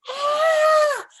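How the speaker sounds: high, loud screaming cackle; scariest scream. Acted. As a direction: scream it out to spook grandma. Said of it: "kept on screaming".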